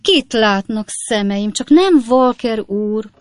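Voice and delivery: high, slow, loud exclamation; hammed-up joyful cry; feigned joyful surprise. A narrator's reading. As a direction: gush with fake delight. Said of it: "mintha nagy öröm érte volna".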